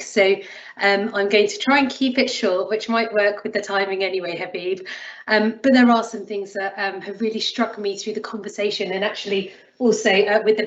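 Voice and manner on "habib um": ordinary talk, nothing marked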